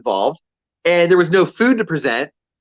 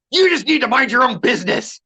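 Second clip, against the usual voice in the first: higher mock-indignant retort; retold indignant customer; 7 semitones higher than usual. Rude customer telling off the person filming her, indignant. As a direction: act out the huffy customer telling you off.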